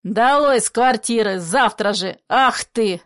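loud furious eviction; a father stamping his feet, shouting loudly; stamping rage. Acted. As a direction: roar them out of the flat. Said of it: "Топая ногами".

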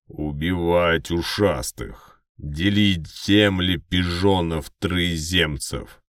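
slow, loud orc snarl. Acted. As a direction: growl it like a savage orc. Orc shouts on TV.

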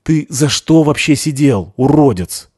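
barely held anger. A narrator's reading. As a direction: hold the fury barely in. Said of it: "еле сдерживается".

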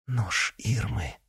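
slow, soft moan. Acted. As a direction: groan the name out in dread. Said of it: "простонала она".

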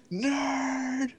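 high, loud mocking shout of nerd; mock yell. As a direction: yell it out like a playground taunt.

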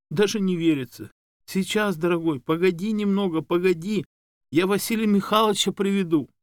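high, doting woman's voice; excited fussing. A narrator's reading. Woman telling her dog to wait a bit, excitedly doting. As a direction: fuss over the dog in a high register.

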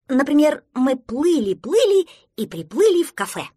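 high, loud, eager invention; excited storytelling. Acted. Prostodursen invents pleasant outcome, excited. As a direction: make it up with loud delight.

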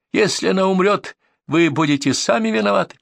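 high-pitched desperate threat; hysterical shouting. A narrator's reading. Hostage-taker blames the pursuers, crying out high. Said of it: "продолжал кричать Ренард".

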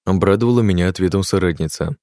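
usual speaking voice

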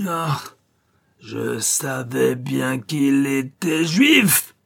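very slow, rising, hammered cry; syllable-by-syllable yell. A narrator's reading. Officer bent over the dead man, howling each syllable. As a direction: punch out each syllable, climbing to a shout. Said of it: "hurla-t-il, ponctuant chaque syllabe".

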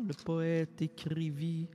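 ordinary talk, nothing marked

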